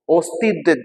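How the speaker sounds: ordinary delivery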